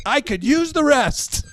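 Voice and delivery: high, slow, mock-weary mom; deadpan a tired mom's joke, drawn out; hammed-up exhausted mother; mom's dry comeback. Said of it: "My mom would be like".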